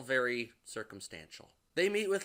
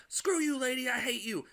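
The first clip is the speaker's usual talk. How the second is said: high, mock furious snap; mock angry retort; 10 semitones higher than usual. To the teacher lady, a mock angry outburst. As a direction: spit it back at her, hammed-up.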